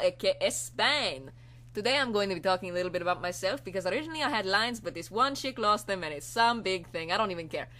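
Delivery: put-on Spanish-accented character voice; lively rambling with a put-on Spanish accent; Spain. Spain introducing himself to the internet, cheerful Spanish accent. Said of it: "cavortsing about in Spain's voice".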